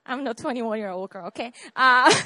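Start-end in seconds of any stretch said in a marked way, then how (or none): 1.8-2.3 flustered laugh. Real, not acted. Laughing at her own slip.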